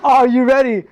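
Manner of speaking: hype question. Real, not acted. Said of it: "I said".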